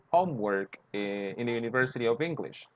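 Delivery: ordinary talk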